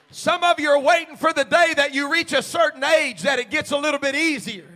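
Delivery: loud, rising sermon shout; full-force preaching. Acted. Preacher urging his church, loud and building. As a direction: drive it home to the congregation.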